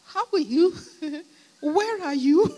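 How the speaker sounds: high, loud, sing-out friendly phone greeting. Retold or imitated. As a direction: pretend nothing is wrong, laughing between questions. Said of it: "nice, um, anxiety-less voice".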